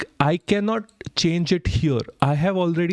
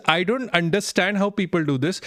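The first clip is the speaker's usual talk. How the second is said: ordinary talk, nothing marked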